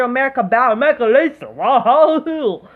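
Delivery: mocking caveman voice. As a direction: sneer it out like a simpleton. Mocking the man's claim.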